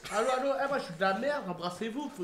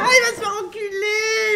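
slow, high wailing laugh; laugh-crying shout; 11 semitones higher, slower than usual. Real, not acted. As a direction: wail it through laughter.

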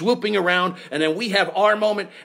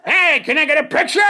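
loud, high, shticky holler; projecting to back row; 11 semitones higher, 6 dB louder than usual. Acted. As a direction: belt it hammily to the balcony. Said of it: "like I'm projecting to the back row".

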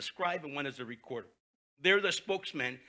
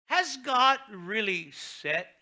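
slow, loud, sly serpent voice; slow mocking serpent question; tempting serpent; 8 semitones higher, 8 dB louder, much slower than usual. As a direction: draw it out like the serpent.

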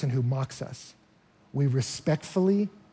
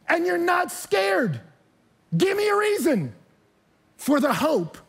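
demanding persecutors; 10 semitones higher than usual. Acted. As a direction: demand an answer, rising hard. Persecutors demanding why he's unafraid.

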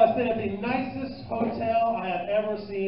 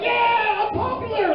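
much higher and louder, giddy; mock proud girlfriend; 14 semitones higher, 8 dB louder, slower than usual. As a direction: gush like a girl bragging. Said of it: "She's like".

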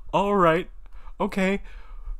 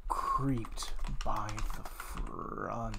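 soft, slow spooky reading; a soft, slow creepy storyteller voice; scary read; 2 semitones lower, 9 dB quieter, slower than usual. Acted. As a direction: hush it like a ghost story. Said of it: "Let's go for something scary".

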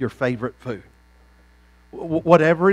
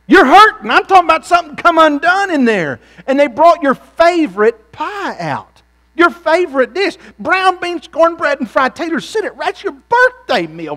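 preaching at full force; 13 semitones higher, 10 dB louder than usual. Acted. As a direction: thunder it at the congregation.

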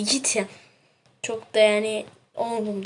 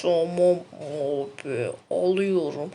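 deep slow voice; 4 semitones lower, slower than usual. Acted.

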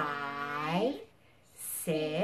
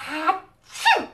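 big high fake sneeze; 10 semitones higher, 8 dB louder than usual. Shown as a demonstration. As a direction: ham up a loud achoo. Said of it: "Do the elephant when you sneeze".

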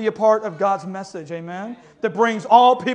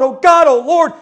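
louder, higher pleading cry; desperate call to God, louder; 5 semitones higher, 7 dB louder than usual. Retold or imitated. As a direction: plead like a people in despair. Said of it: "they're desperate".

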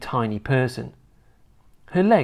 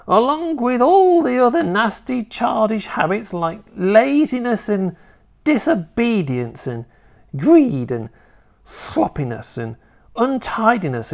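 put-on scolding old grandma; 11 semitones higher, 4 dB louder than usual. Grandma telling off her growing grandson, scolding.

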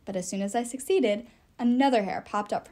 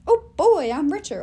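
gleeful; 5 semitones higher, 9 dB louder than usual. Pretend. Imagined gleeful reaction.

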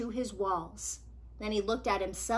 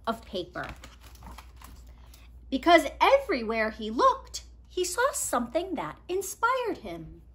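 put-on lively read-aloud for kids; storybook reading; 3 semitones higher, 4 dB louder than usual. A narrator's reading. Reader telling children a picture book story, lively.

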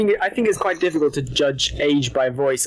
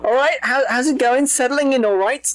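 cheery coworker; 8 semitones higher than usual. Retold or imitated. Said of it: "he was always like".

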